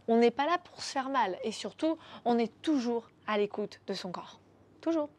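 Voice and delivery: soft unvoiced whisper; hushed coaching whisper. Real, not acted. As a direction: breathe it out intimately.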